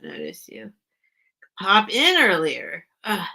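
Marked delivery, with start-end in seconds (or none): 0.0-0.7 sheepish apologetic whisper. Real, not acted. Apologising to Fiona for missing her, whispered.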